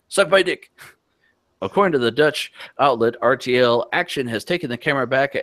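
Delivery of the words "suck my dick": higher, hammed-up shout of the troll; a retold troll yelling, pitched up; mock troll shout. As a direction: bark it like a troll losing his cool.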